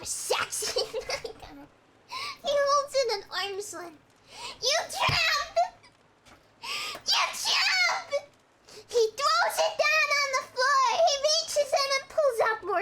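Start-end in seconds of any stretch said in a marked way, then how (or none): 4.6-8.3 high, louder sobbing curse; crying shout. Acted. Heartbroken man shouting at his girlfriend's clothes, crying. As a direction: spit it through tears.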